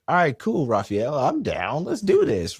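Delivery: loud, high, pumped-up reply; eager agreement. Retold or imitated. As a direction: act out being totally game. Acting out eager agreement on phone.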